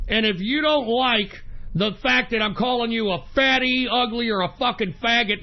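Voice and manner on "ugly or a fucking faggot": usual speaking voice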